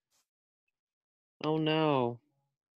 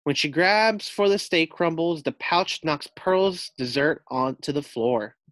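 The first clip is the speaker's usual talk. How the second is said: plain reading voice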